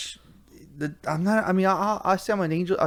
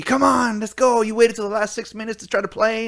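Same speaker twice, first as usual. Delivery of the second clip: higher mock fan yell; 7 semitones higher than usual. Retelling yelling at the Cowboys on TV, mock yell. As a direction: shout at the team on screen. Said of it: "yelling in front of the T V".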